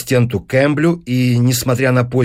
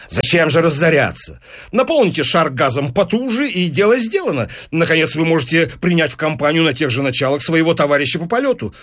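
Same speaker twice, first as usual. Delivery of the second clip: high-pitched, animated sales pitch; persuasive newspaper businessman; 5 semitones higher than usual. Acted. Businessman selling the professor on records, persuasive. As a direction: talk up the profits with relish.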